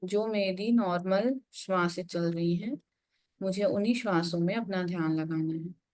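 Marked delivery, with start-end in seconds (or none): none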